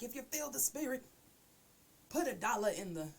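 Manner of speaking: preacher voice asking for money; mock church collection plea; church offering call. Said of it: "that part of church where they're like".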